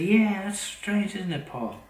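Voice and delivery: imitating her prying question. Mimicking the woman fishing for information from Paul, high and slow. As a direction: stretch it out high and sing-song, faking curiosity.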